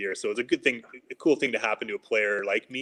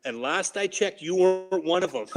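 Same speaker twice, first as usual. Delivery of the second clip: raised pitch, imitating a coach's telling-off; mock yell; 7 semitones higher than usual. Coach coming right over to the rookie by the door, mock yell. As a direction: hand down the scolding as a retold punchline. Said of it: "he's trying to yell at me".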